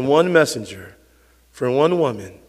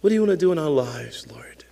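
congregational prayer; 2 semitones lower than usual. Real, not acted. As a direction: pray earnestly, settling from full voice into softness. Leads the closing prayer.

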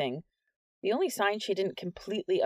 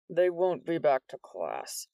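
lightly voiced stern demand; menacing principal; slower than usual. A narrator's reading.

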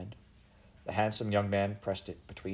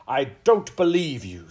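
loud, slow, higher-pitched royal anger; angry disbelief; 5 semitones higher, 9 dB louder than usual. Acted. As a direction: throw the claim back at the accused. Furious Queen rejects Eliza's claim.

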